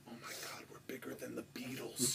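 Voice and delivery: hushed, breathless whisper to his wife; awed whisper. Retold or imitated. Man whispering in awe to his wife beside him. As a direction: lean in and whisper it in disbelief.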